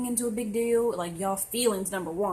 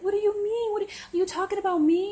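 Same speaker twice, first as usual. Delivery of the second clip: very high, louder indignant whine; hammed-up defensive questioning; mock offended; 12 semitones higher, 4 dB louder than usual. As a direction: imitate someone taking it all personally. Imitates Cancer taking rant personally.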